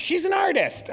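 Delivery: high-pitched doting grandma protest; indignant, high-voiced defence; defensive mother. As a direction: imitate a mom excusing the child, pitch way up.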